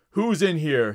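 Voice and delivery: loud guard's challenge; yelling. A narrator's reading. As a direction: call out sharply into the room. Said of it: "he yelled".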